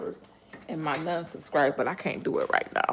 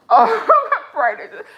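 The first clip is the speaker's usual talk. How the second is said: high laughing burst, much louder; 12 semitones higher, 9 dB louder than usual. Real, not acted. Telling viewers about a thousand subscribers, laughing high and loud. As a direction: laugh it out in giddy disbelief.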